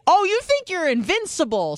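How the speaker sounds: high-pitched, incredulous imitation; mocking disbelief. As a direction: mimic a scolding nurse with exaggerated high scepticism. Mimics nurse's sceptical reaction.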